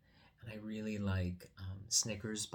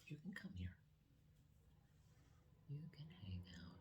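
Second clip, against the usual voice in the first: soft whisper; 3 semitones lower, 17 dB quieter, faster than usual. Real, not acted. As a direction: whisper it gently so he stays calm. Soothing a shy child nearby.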